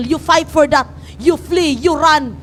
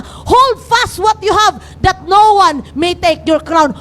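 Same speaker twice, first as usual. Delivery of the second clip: "Hold fast what you have" shouted out slowly, every word hammered; slow shouted charge to hold fast; shouted exhortation; 3 semitones higher, slower than usual. Acted. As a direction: call it out to the whole church. Repeats the verse in exhortation.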